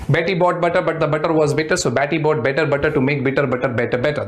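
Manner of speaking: Betty bought butter rattled off at speed; fast model speed for Betty bought better butter; fast tongue twister. Shown as a demonstration. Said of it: "This is the speed you need to get".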